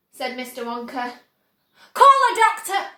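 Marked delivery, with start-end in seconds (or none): 1.9-2.9 high, loud shout for help. A narrator's reading. Father shouting for help for his daughter, loud. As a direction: shout it urgently. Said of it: "shouted Mister Beauregard".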